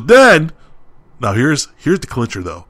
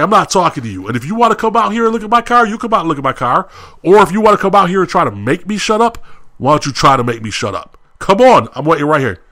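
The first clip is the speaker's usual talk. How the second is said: heated, higher-pitched re-enacted challenge; angry dare; 6 semitones higher, 3 dB louder, faster than usual. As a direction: act out daring him to step outside. Retold dare to the cook.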